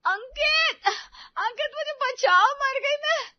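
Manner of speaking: very high, loud panicked wail; terrified cry for help. Acted. Fallen daughter-in-law screaming to husband, terrified wail. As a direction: scream for your husband in terror. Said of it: "रिया इतनी डर गई".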